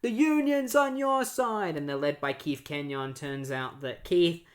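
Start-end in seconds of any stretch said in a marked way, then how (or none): 0.0-1.8 higher, slower cheer of a crowd of citizens; rallying crowd voice. Retold or imitated. Citizens rallying behind the Flash, lightly voiced cheering crowd. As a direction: hype it up like a whole crowd backing the hero. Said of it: "They're just like".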